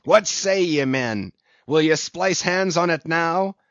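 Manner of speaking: eager commanding call; rallying challenge. A narrator's reading. Ahab rallying his men.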